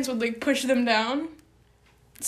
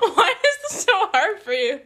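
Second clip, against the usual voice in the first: laughing; 7 semitones higher, 3 dB louder than usual. Real, not acted. Laughing at the host.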